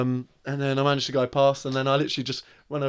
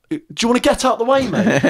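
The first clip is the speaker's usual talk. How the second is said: higher, louder mock shout out the window; retold yell at pedestrian; 10 semitones higher, 5 dB louder than usual. Driver retelling shouting at a man in the road, loud and high. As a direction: call out at him from the car.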